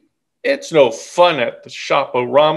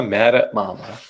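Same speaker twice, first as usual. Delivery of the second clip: ordinary delivery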